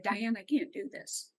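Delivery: quietly retold, barely above a breath; hushed tearful plea. A woman in the front row tells the speaker, softly and tearfully. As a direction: say it softly, as if you can't go on.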